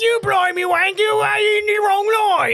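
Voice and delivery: hammed-up, high-pitched British rant; angry British. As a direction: imitate him shrieking in a mock British accent. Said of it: "British when he's angry".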